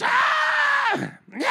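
high-pitched demon-possessed scream; imitated scream. Preacher imitating possessed man in church, high screaming. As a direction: mimic a full-on shriek. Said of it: "he starts screaming".

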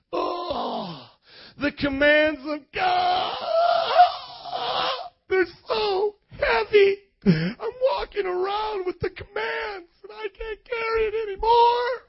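high, strained fake-crying wail; hammed-up whine under a crushing load; mock whining complainer. Voices people burdened by God's commands.

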